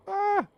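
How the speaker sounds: very high, loud yelp; startled yelp. Real, not acted. Player nearly walking off an edge, startled yelp. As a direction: cry out in sudden alarm.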